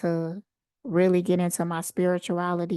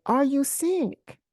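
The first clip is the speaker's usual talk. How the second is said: higher, drawn-out retold call; mock yell; 7 semitones higher, slower than usual. Woman calling after the narrator around the hall, mock yell. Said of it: "she was yelling, like".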